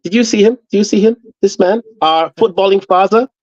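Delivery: mock German accent, preacher-like; hammed-up German orator hailing the father. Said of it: "Why the German accent".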